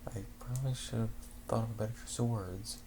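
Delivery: quietly regret the word choice; embarrassed aside. Real, not acted. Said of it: "all that good jizz".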